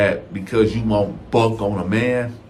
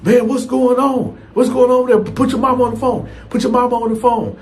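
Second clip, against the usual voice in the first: high frantic questions, getting faster; panicking; 12 semitones higher, 3 dB louder than usual. Retold or imitated. Baby daddy hears the fight over the phone, panicking high. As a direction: sound panicked on the phone, pitch up. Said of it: "he panicking".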